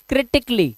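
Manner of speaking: critically as "KRI-tik-lee"; correct pronunciation. Shown as a demonstration. Teacher has class practise critically as "kritik-lee", clearly pronounced. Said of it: "इसको बोलने की आदत डालें".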